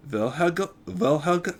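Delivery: put-on German accent, tripping over the name; stumbling into a German accent; stuttered German accent. Big Doc greets Helga, stuttered German accent. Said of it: "Sorry, stuttering in an accent".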